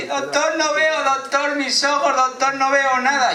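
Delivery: high, hammed-up cry for help; panicked wailing. Comic as blind patient calling to the doctor, high mock wailing. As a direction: wail desperately for the doctor.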